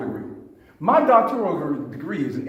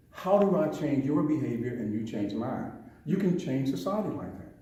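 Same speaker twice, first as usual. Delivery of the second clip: ordinary talk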